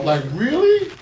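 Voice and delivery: high, loud burst of disbelief; loud, incredulous reaction; astonished. Real, not acted. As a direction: blurt it out in amazed surprise. Reacting to the phone glasses.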